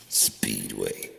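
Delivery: ordinary talk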